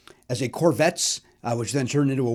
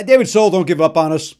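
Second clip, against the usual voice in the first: ordinary talk, nothing marked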